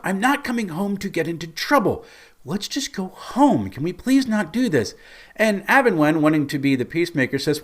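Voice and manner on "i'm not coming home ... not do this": high-pitched imitation of an exasperated friend; mock fed-up begging; exasperated pleading. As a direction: plead to be done with trouble, pitched well up. Ingva voiced pleading with troublemakers.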